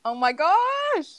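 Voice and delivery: slow drawn-out excited gasp. Real, not acted. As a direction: stretch it out in giddy disbelief. Waiting for the countdown.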